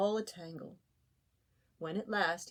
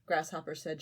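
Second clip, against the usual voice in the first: ordinary delivery, nothing marked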